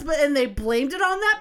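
ordinary delivery

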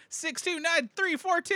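high-pitched yelled-out numbers; mock yelling. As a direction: call out code numbers in a high, mock yell. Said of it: "the whole time they're yelling out".

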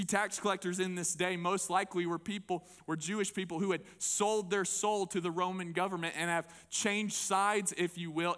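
normal talking voice

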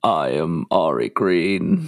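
swallowed throaty voice. Pretend. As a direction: pull the voice down into your throat. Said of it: "it sounded like feedback".

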